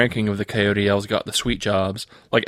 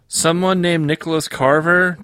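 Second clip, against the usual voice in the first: put-on slow, higher young elf voice; christmas elf; 7 semitones higher than usual. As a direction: read the name out slowly, puzzled. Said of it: "Tad replied".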